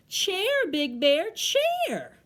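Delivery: high, punchy storybook call; exclaimed mishearing. Acted. Bear thinks Tree said chair, exclaimed high. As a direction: call it out high with a big final swoop.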